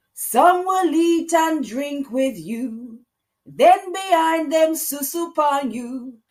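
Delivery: put-on high, loud, slow Jamaican-accented lyric, half sung; Jamaican twang. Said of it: "That's my Jamaican, uh, twang there".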